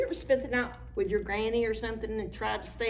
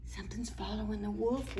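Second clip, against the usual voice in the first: fast hushed whisper; 7 dB quieter, faster than usual. Acted. Animal whispering about the wolf. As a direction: breathe it out secretly.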